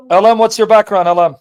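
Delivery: loud call to a caller. Real, not acted. Host calling the next caller.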